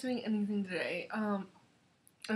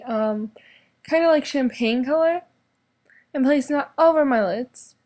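loud, brisk makeup tutorial narration; upbeat beauty voiceover; 9 dB louder than usual. Acted.

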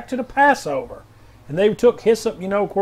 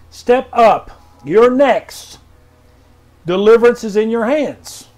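prophetic proclamation; 3 semitones higher, 6 dB louder than usual. Acted. Pastor reading R J W's word, loud and commanding. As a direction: thunder it out like a prophet.

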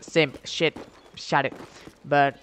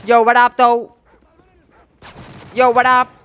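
hype shout; 7 semitones higher, 9 dB louder than usual. Real, not acted. Catchphrase called out again.

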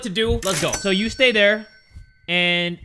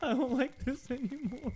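muttered; 4 semitones higher, 12 dB quieter than usual. Real, not acted. Said twice after scare.

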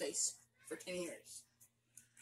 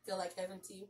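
usual speaking voice

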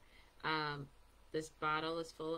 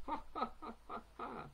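a high, spelled-out ha ha ha; mock laugh; 10 semitones higher than usual. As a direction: play-act a cartoonish laugh. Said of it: "which makes me go".

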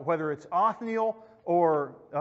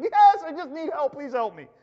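high, loud begging, falling off at the end; desperate pleading; 8 semitones higher, 7 dB louder than usual. Acted. Man dangling from a branch begs God, desperate pleading. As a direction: plead as if losing your grip.

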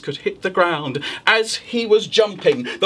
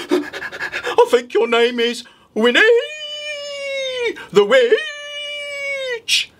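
slow guess rising very high on Witch; wolf guessing; much slower than usual. Acted. Wolf guessing the witch's name, hopeful. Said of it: "he said".

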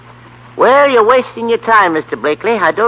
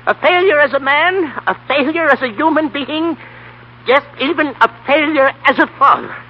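raised, higher, indignant scolding; angry tirade; 7 semitones higher than usual. Acted. Grandpa telling Mister Kirby he's a failure, raised and indignant. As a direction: lift the voice and let him have it. Grandpa tells off rich man.

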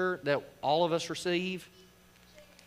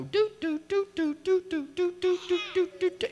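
quick run of high-pitched "doot" sounds; high "do-do-do" dipping noise; 13 semitones higher than usual. Retold or imitated. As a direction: mime repeated dunking with high little toots. Mimes washing seven times in river.

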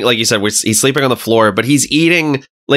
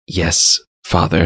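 low, solemn delivery; dramatic interpreter; 7 semitones lower, slower than usual. Retold or imitated. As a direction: lower your voice for mock-dramatic translation. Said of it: "has some dramatic translations".

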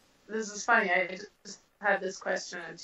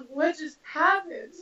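stunned disbelief; 7 semitones higher, 4 dB louder, slower than usual. Retold or imitated. Stunned after first punch.

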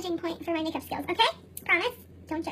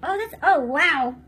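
usual speaking voice

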